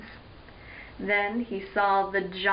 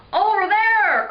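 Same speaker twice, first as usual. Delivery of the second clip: high, loud drawn-out call; cried out; 9 semitones higher, 7 dB louder than usual. Acted. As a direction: call out to fellow hunters. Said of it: "cried a lizard rider".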